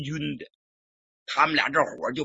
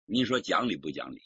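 usual speaking voice

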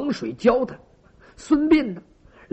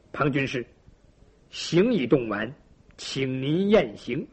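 subordinate's report; 7 semitones lower, 5 dB quieter than usual. A narrator's reading. Underling reports to his master.

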